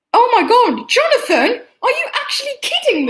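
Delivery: can't believe his friend showed up; excited disbelief. Real, not acted. Boy sees his friend in the stream chat, delighted laughing disbelief.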